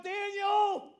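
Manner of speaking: loud, high, drawn-out call. Retold or imitated. King calls down into the lions' den, calling out loudly. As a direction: shout a name down into a den. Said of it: "he calls down".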